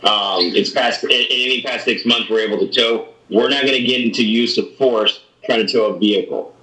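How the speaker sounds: ordinary talk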